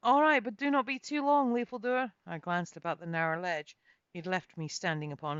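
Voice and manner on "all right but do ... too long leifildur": raised, lightly voiced heroine; a touch higher and louder, hesitant agreement; Kate's voice. A narrator's reading. Kate agreeing reluctantly to Leifildur, lightly voiced.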